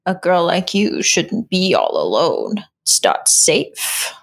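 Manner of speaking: low, slow creepy warning; a horror-movie harbinger, slow and low; slow ominous raspy drawl. Retold or imitated. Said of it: "like a typical, like, horror movie harbinger".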